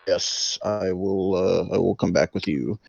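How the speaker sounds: put-on low, soft old man; quiet, deepened voice of an old character; harshened old-man voice. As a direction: drop the voice into a hushed, aged register. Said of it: "was harshen my voice a bit".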